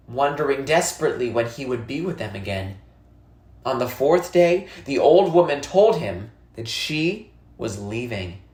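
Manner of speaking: ordinary delivery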